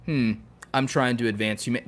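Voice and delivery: ordinary talk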